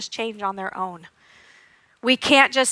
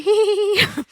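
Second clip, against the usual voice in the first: higher, breathless hee hee hee; activated giggle; 8 semitones higher than usual. Shown as a demonstration. Showing parents how a wound-up child giggles, high hee hee hee. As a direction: giggle with no air behind it. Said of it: "When you're not calm".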